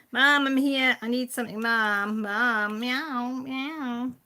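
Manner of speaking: mimicking the cat calling Mum from upstairs; cat yelling for mum. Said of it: "she has to yell at her".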